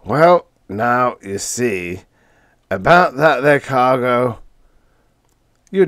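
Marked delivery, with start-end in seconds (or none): 0.0-4.4 hesitant, stalling delivery; uneasy, evasive drawl of the bad news; hedging. A narrator's reading. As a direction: hem and haw to buy time. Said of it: "He hedged".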